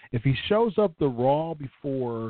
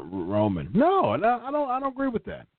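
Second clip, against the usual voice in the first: usual speaking voice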